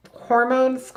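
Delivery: usual speaking voice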